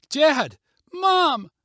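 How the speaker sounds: high, loud frantic cry; panicked yell. A narrator's reading. Girl yelling for her parents after the landslide. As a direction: scream for your falling parents. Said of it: "Kara yelled toward the direction".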